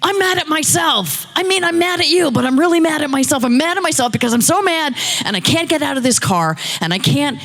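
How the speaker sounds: high-pitched ranting re-enactment; retold angry shouting. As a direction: rant in a high voice, piling complaint on complaint. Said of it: "so then I shouted again".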